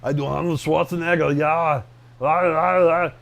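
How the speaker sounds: a hint of Austrian Arnold accent; lightly voiced Arnold Schwarzenegger. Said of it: "I've heard you do Arnold".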